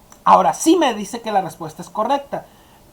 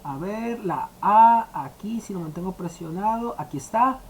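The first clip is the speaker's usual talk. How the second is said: softer, lower, absent-minded aside; muttering to self; 4 semitones lower, 6 dB quieter than usual. Real, not acted. Teacher to himself, looking for the accented A, muttering low. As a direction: mumble to yourself while hunting for a key. Talks to self while searching keys.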